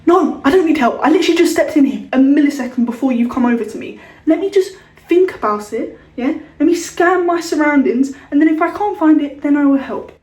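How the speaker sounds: loud exasperated retort; mock snapping back. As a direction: tell him off, loud and fed up. Imagined retort to shop assistant.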